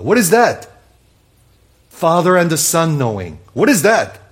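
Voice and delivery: loud, high fervent preaching; full-force preaching. Real, not acted. Pastor thundering scripture to the congregation, fervent shout. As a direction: proclaim it to the rafters.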